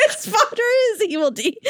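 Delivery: high laughing through words; laughing hard. Real, not acted. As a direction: crack up while speaking. Laughing fit.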